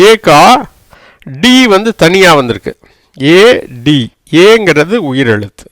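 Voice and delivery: normal talking voice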